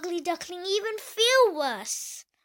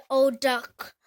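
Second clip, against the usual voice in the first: usual speaking voice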